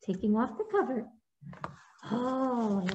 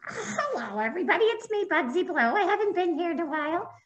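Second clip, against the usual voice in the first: higher, friendly bug voice; a returning little bug greeting, pitched up; higher, cheerful puppet hello; Bugsy puppet voice; 7 semitones higher than usual. Acted.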